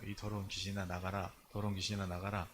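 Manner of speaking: low, quiet, commanding deliverance voice; a hint of an exorcist rebuking a spirit; exorcism command. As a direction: cast the demon out, low and hushed. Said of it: "이 귀신 쫓는 사역하니까".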